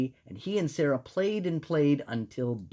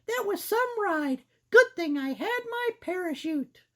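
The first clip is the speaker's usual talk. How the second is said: put-on squeaky girl mouse; high-pitched cartoon mouse. Voicing Sarah the mouse.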